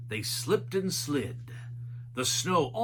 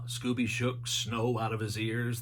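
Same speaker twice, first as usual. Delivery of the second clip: ordinary delivery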